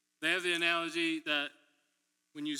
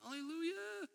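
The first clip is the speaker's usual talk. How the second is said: very high, soft falsetto; mock hallelujah; 13 semitones higher, 9 dB quieter than usual. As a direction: float a mock praise up high and quiet. Mock praise at bad repair news.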